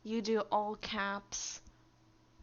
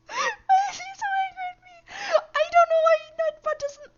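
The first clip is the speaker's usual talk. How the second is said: very high, loud, fast complaint; laughing whine; 13 dB louder, faster than usual. Real, not acted. Streamer whining at the bot through laughter. Bot keeps timing him out.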